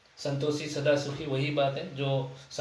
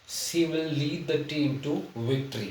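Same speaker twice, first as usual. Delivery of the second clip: slow, word-by-word dictation of the sentence; slow example reading; much slower than usual. Shown as a demonstration. Teacher reads the example sentence slowly to students. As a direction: read it out slowly for the class to follow. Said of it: "reads example sentence slowly".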